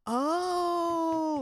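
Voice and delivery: loud, high, drawn-out OH; excited squeal. Retold or imitated. Friend hearing this is Ricky, excited drawn-out oh. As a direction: imitate an over-excited girl's long whoop. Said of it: "And she got all excited".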